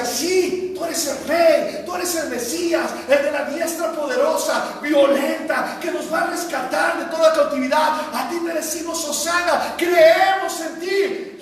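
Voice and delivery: loud, high acclaim; the crowd hailing their king. Retold or imitated. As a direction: hail him as the crowd did. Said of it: "esa gente decía".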